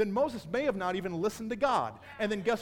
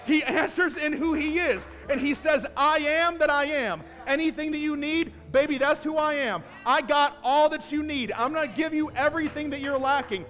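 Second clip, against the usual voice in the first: loud, high, building sermon climax; preaching at full force; 6 semitones higher, 9 dB louder than usual. Acted. Pastor rousing the church to stand and worship, full preaching voice. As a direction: drive it home to the congregation.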